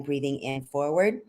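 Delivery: ordinary talk, nothing marked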